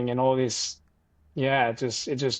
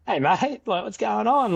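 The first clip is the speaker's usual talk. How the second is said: surprised greeting; 9 semitones higher than usual. Retold or imitated. Surprised greeting of old friend.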